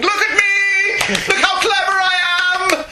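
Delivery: high, slow, laughing show-off cry; mock show-off scream. Podcast host mocks a smug writer with a hammed-up high cry. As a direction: ham up a boastful writer crying out for attention. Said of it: "the writer's screaming".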